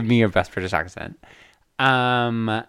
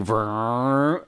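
revving engine imitation; car noise. Shown as a demonstration. Sorority girl showing her best car noise, engine imitation. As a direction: roll out a rising engine rev. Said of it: "your best car noise".

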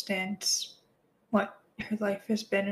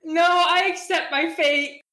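mock tragic; 7 semitones higher, 7 dB louder than usual. Melodramatic acceptance.

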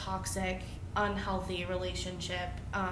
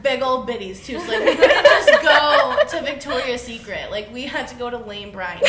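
laughing through the words, louder; giggling while telling it; 4 semitones higher, 14 dB louder than usual. Real, not acted. To friends about bra shopping, laughing.

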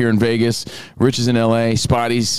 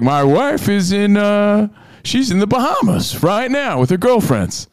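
imitating the Uber driver; 10 semitones higher, slower than usual. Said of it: "He was like".

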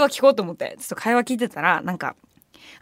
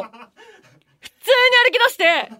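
笑いながら; 11 semitones higher, 4 dB louder, much slower than usual. Real, not acted. 驚きと笑い.

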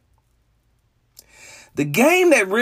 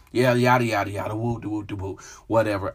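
low, bored brush-off; dismissive; 10 semitones lower, 5 dB quieter, faster than usual. Real, not acted. Fan unimpressed by the new coach hire, dismissive. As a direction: wave the news away with a low mumble of filler. Waving away the hiring news.

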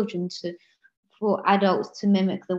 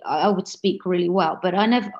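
ordinary talk

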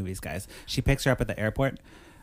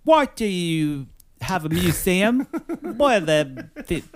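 put-on kid voice; 7 semitones higher than usual. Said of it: "she's like".

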